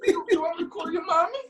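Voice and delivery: high, sing-song mocking; mock teasing sergeant. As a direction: taunt like a sergeant mocking a recruit. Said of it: "Everything was like".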